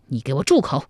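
ordinary delivery

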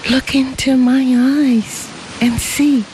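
put-on high, light girlish voice; young woman. A narrator's reading. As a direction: speak as a gentle young bride.

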